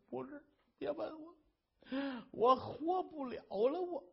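despairing wife. Acted. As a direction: plead with a thin, broken voice. Wife begging him not to ask.

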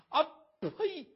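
loud, high "pah" of disgust; scornful spit. Acted. Outraged wife to her captor, scornful spit. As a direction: spit the word out explosively.

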